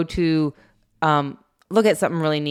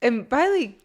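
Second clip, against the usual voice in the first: usual speaking voice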